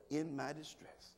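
dropped low and soft, rising at the end; soft repeat. Shown as a demonstration. Pastor repeating the verse quietly so the church takes it home. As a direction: hand them the verse again softly. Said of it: "If you don't get nothing else, put that somewhere".

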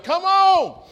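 loud, high yell with a stretched ON. Shown as a demonstration. Pastor yelling to his blindfolded wife. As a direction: holler it out to be heard. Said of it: "I'm yelling".

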